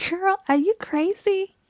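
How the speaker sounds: high-pitched, scandalized disbelief; incredulous. Acted. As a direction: balk at your best friend's outrageous idea. Friend shocked by threesome proposal.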